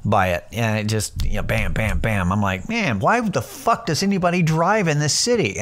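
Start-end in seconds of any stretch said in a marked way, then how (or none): 2.7-5.6 high-pitched incredulous question; baffled amazement. Retold or imitated. Tourist floored by the subway wondering about drivers, incredulous. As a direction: exclaim in exaggerated disbelief.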